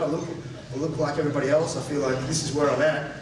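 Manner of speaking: ordinary talk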